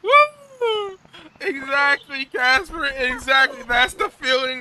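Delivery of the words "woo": much higher, loud whoop; celebratory woo. Real, not acted. Streamer celebrating with chat, loud whoop. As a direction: whoop in victory.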